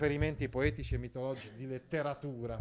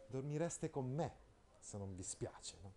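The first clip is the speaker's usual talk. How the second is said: sly hint; 12 dB quieter than usual. Shown as a demonstration. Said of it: "come dire, insomma".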